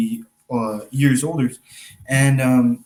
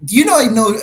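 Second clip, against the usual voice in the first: higher and louder, protesting; exasperated reply; 9 semitones higher, 6 dB louder than usual. Retold or imitated. Magician answering his girlfriend's teasing, exasperated and loud. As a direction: push back loud and high, defending yourself. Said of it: "I'm like".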